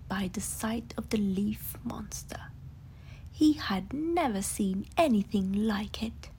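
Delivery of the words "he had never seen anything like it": ordinary delivery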